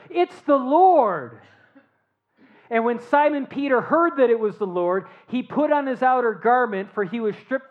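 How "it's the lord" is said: slow, high, loud announcement; awed recognition. Acted. Beloved disciple telling Peter who stands on shore, awed and slow. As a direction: proclaim it slowly with wonder. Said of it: "That disciple whom Jesus loved, therefore, said to Peter".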